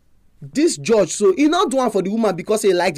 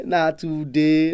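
na today stretched very slowly and lower; slowed, lower repeat of na today; slow, drawn-out emphasis; 4 semitones lower, much slower than usual. Shown as a demonstration. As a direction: drag out na today for weight. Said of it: "repeated, drawn out".

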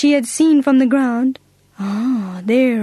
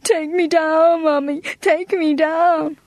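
higher, slow tearful begging; crying plea; 5 semitones higher, slower than usual. Acted. Girl in the tree begs Mummy, slow tearful plea. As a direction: plead slowly, close to tears. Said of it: "cried poor Agatha".

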